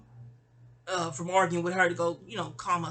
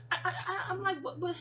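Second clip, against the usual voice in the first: high, softer stuttered disbelief; retold stammering shock; 9 semitones higher, 5 dB quieter, faster than usual. Recalling the cousin's outburst, stammering in mock shock. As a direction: act lost for words, pitched up.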